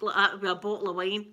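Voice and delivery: ordinary talk, nothing marked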